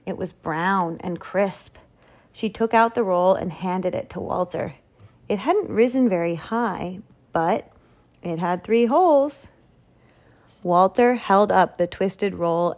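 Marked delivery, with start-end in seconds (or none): none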